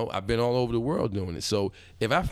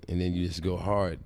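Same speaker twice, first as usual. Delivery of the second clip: usual speaking voice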